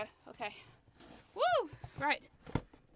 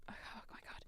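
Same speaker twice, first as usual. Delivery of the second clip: barely voiced aside; hushed despair; exasperated whisper; 21 dB quieter than usual. Real, not acted. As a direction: whisper it to yourself in dismay. Exasperated aside while struggling.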